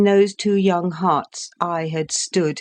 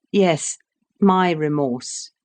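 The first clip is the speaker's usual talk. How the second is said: ordinary delivery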